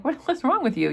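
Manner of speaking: lightly acted, high-pitched startled question; startled concern. Mother to daughter acting out of character, mildly startled. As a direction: jump up high in mild disbelief.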